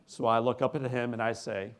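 usual speaking voice

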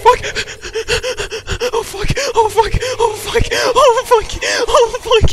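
high loud panicked swearing; terrified screaming. Real, not acted. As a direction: shriek the curses in terror. Chased by monster.

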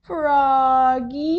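loud, drawn-out singsong call; mom calling out. A narrator's reading. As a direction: call the child from another room. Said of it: "called his mom in the morning".

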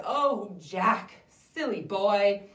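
gently chiding a foolish son; slow, loud silly boy; exasperated mother. Acted. Mother scolds Jack.

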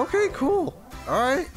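high-pitched, light okay; upbeat acknowledgement. Real, not acted. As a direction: chirp it brightly up high. Reacting to game tutorial.

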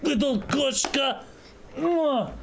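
high-pitched cooing to a cat; fond, high pet talk; cooing affection. Pretend. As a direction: coo it in mock Russian.

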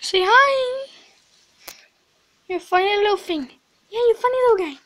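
sing-song pet voice; dog-talk voice. Real, not acted. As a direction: coo up high at the puppy.

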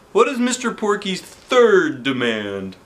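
high, slow worried question; principal's voice. A narrator's reading. Principal asking the third demand, high slow worry. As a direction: ask anxiously.

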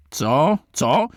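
sharp high outraged cry; indignant prince shouting at the nobleman; shouted. A narrator's reading. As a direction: shout it out in disbelief. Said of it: "krzyknął Radziwiłł".

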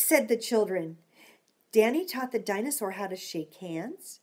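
usual speaking voice

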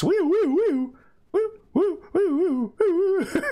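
very high, loud police siren woo; high looping siren whoops; siren imitation. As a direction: wail like a cop siren. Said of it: "Call the cops".

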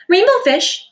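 little fish calling. Acted. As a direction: call after him eagerly. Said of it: "he called".

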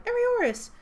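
very high, loud cry of the name; cried out. A narrator's reading. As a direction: cry out in alarm. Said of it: "Felicia cried".

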